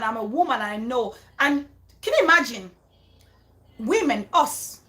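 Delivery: ordinary talk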